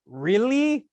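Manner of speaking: Really? lifted high in doubtful surprise; surprised really. Retold or imitated. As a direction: mimic the audience's disbelief. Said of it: "For everybody in the room who went".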